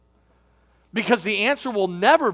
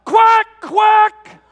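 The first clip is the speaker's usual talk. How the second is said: cheering shout; 12 semitones higher, 8 dB louder, much slower than usual. Retold or imitated. Dad cheering his son's goal from the sidelines, full-voiced shout. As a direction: yell "Yeah, Mason" and "Quack, quack" high and loud from the stands. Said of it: "And I said".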